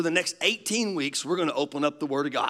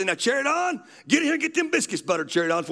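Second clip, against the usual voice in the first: high, quick holler to Cherry Dawn; granddaddy calling his wife; 7 semitones higher, faster than usual. Retold or imitated. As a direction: hurry her along from the table. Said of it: "he'd say, he'd say".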